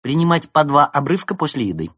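громкая едкая насмешка; поддеть с высоким тоном; язвительно. A narrator's reading. Берта продолжает язвить. (ехидно)

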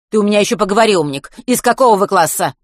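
vice-principal scolding Matvey. A narrator's reading. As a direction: snap it at the cheeky boy. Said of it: "повысила голос Зоя Валентиновна".

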